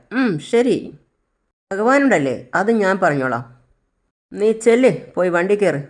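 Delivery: a kidnapper loudly scoffing, then commanding; mocking villain order. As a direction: scoff at her God and order her off. Villain mocks and orders her.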